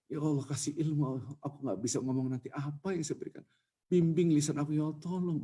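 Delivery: preacher begging God before a sermon; pleading prayer. Pretend. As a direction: plead quietly for words to speak. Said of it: "Doa itu harus melas".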